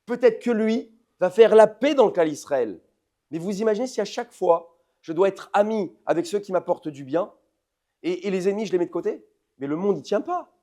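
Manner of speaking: loud, high, insistent preacher's voice; fiery preaching. Acted. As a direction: hammer the point home to the congregation. Preaching at full force.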